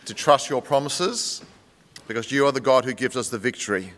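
low, hushed prayer voice. Real, not acted. Pastor leading the congregation in prayer, hushed and low. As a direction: lead the flock in quiet prayer.

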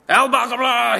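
high, loud mock Scottish war cry; hammed-up William Wallace yell, blurred into nonsense; mock battle yell. Preacher mimicking the Braveheart battle cry, hammed-up yell. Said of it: "he didn't do some yelling thing".

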